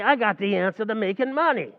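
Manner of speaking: normal talking voice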